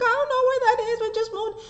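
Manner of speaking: panicked. Retold or imitated. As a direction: blurt it out in a mock-panicked high voice. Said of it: "I was just panicking".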